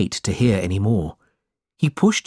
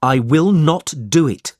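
firm refusal, pitched up; angry reply; 6 semitones higher, 4 dB louder than usual. A narrator's reading. Nephew refusing his uncle's order, angry. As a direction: refuse with restrained anger. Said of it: "Nicholas replied angrily".

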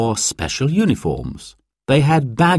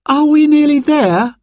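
put-on very high, louder child's question; 6 dB louder than usual. Sarah to Dad on a hot car journey, child's high voice. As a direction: pipe up high like an impatient child.

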